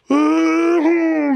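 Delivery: sharp high gasp before breaking into tears; crying gasp. Retold or imitated. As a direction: imitate a friend's choked gasp as he starts to cry. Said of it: "starts crying".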